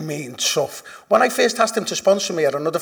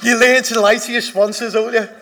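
laughing, louder and higher; 7 semitones higher, 8 dB louder than usual. Real, not acted. Caught out by the room, laughing it off. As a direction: defend yourself through laughter.

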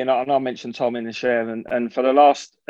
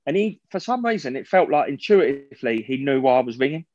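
ordinary talk

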